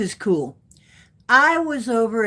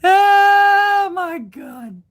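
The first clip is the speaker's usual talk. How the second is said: drawn-out oh my God; 10 semitones higher, 7 dB louder, much slower than usual. Real, not acted. Overwhelmed exclamation, laughing.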